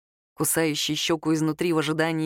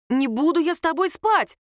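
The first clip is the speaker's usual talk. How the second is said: high-pitched outburst, moderately louder; indignant exclamation; 8 semitones higher, 4 dB louder than usual. A narrator's reading. Girl refuses him in a café, high indignant exclamation. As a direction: exclaim it for the whole café to hear. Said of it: "воскликнула Лера на всё кафе".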